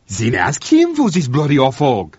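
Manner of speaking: loud triumphant jab. A narrator's reading. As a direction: crow it, triumphantly. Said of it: "said Blanchard triumphantly".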